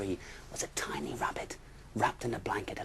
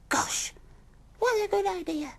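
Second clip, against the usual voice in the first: very high, louder impressed rabbit; admiring rabbit; 8 dB louder than usual. Acted. Father rabbit praising the bear, high and impressed. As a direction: sound impressed.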